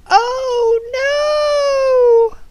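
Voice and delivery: high, long-drawn cry of dismay; alarmed cry. Acted. Reacting to Katerina not listening, alarmed cry.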